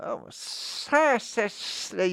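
sassy snake with long hissed S's; hissing snake. Shown as a demonstration. Voice teacher adding S's to a snake, sassy hiss. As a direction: draw every S out, high and very slow. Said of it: "put some S's in there like that".

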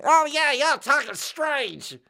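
loud, very high, slow mock drawl; exaggerated drawling accent. Newcomer among people talking another way, exaggerated drawling accent. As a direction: ham up a big drawling regional accent. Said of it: "everybody's talking like this".

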